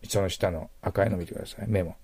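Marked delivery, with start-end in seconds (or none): none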